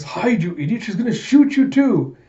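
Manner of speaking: high, exasperated yell; urgent warning. Retold or imitated. Warning the idiot he will get shot too, urgent yell. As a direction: shout a frantic warning, pitch climbing.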